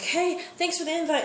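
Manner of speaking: high, breezy mock thanks; fake-sweet thanks. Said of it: "it's like".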